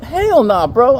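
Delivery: loud, high mock outrage; acted-out emphatic rejection; indignant refusal. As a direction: protest loudly in disbelief. Retold refusal.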